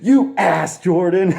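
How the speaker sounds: high, loud mock scold with a laugh; mock outraged wife. Wife scolding her husband, high mock scold. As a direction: squeal it at him. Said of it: "Naomi's all like".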